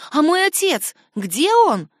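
put-on high, loud, urgent child; anxious plea. A narrator's reading. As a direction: demand an answer fearfully. Frightened daughter asks after father. (высокий тревожный вопрос девочки)

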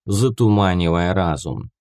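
ordinary delivery, nothing marked

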